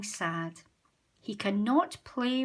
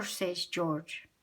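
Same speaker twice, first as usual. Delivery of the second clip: usual speaking voice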